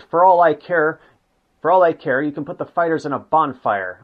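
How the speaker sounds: narrated outburst, pushed up in pitch; raging. A narrator's reading. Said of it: "Goering raged at the Luftwaffe engineers".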